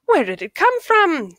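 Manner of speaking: high, loud astonished question; amazed villagers. A narrator's reading. Villagers gasping at the huge potato, high and loud. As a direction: marvel at the giant potato. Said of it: "they asked".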